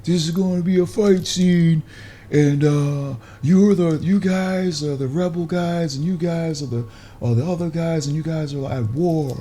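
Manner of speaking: high, drawn-out calls like through a bullhorn; slow, high-pitched set announcements, stretched out; blowhorn announcer. Retold or imitated. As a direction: imitate a crew man on a blowhorn. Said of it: "Guys on a blowhorn".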